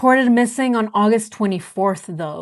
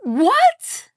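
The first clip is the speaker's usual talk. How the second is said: startled what; 11 semitones higher than usual. Real, not acted. Shocked by claim in recording.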